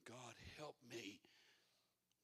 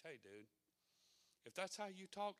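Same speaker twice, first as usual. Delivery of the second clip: usual speaking voice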